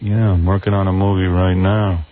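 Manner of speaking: low, slow mock guest drone; George Clooney. Imitated guest mentioning his movie, low slow drone. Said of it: "this is how he talks".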